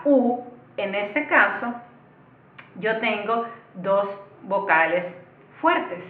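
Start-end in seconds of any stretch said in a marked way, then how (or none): none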